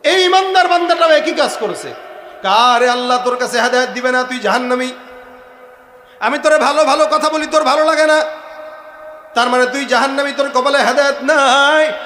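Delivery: loud, high condemning sermon voice; full-force preaching. Acted. Pious man damns the sinner, full-force preaching. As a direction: hammer out the accusation as a preacher.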